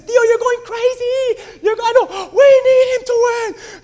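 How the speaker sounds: put-on high, loud, slow girl's voice; excited niece. Niece shouting at her uncle during the race, high excited voice. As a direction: play the niece yelling back at him. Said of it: "my niece is looking at me and says".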